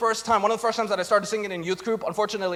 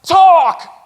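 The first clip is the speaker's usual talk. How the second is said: sharp, much louder single word; emphatic exclamation; 6 semitones higher, 11 dB louder than usual. Acted. Preacher exclaiming to the congregation, loud. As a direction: blurt it out loud. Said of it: "if you can talk and heal somebody".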